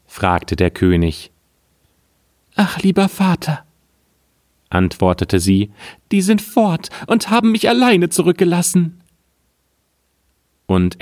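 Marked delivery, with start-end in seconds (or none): 2.5-3.7 put-on much higher, childlike girl's voice; a small daughter pleading to her father; little girl. Girl answers her father the king, high childlike voice. 6.1-9.0 put-on much higher, childlike girl's voice; a small daughter pleading to her father; little girl. Girl answers her father the king, high childlike voice. Daughter tells of lost brothers.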